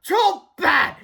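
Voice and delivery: high, loud gleeful yell; delighted shout. A narrator's reading. Mister Crump gloating at the boys, high loud glee. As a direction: crow it with joy. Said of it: "Mister Crump shouted with delight".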